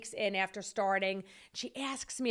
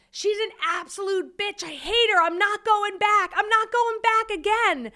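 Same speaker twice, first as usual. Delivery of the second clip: high, loud wailing meltdown, retold; acted sobbing rant; 11 semitones higher, 7 dB louder than usual. Wife bawling to her husband about the boss, acted sobbing rant. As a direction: build from upset to a wailed refusal. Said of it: "And so I'm sobbing".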